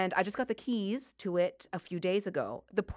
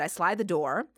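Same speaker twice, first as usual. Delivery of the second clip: usual speaking voice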